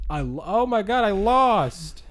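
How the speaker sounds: high, loud shocked cry; shocked disbelief. Retold or imitated. Dom realizing he lost his race, loud shocked cry. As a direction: blurt out the loss in disbelief.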